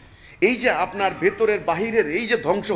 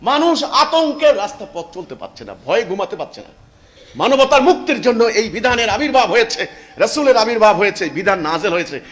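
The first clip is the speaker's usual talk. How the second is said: much higher and louder, fervent preaching; impassioned preaching; 5 semitones higher, 9 dB louder than usual. Acted. Preacher recounting the Prophet's sacrifices, fervent loud preaching. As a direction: cry it out with holy fervour.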